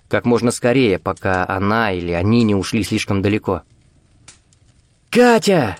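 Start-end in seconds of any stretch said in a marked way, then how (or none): 5.1-5.8 high-pitched yell of a name; raised, urgent call. A narrator's reading. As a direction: yell over rain and crackling fire. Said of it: "снова заорал он".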